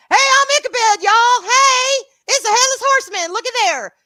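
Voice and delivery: loud, high, over-the-top Southern holler; exaggerated Southern accent, excited and shouting; hammed-up Southern Ichabod. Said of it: "tone down the Southern so it won't be like".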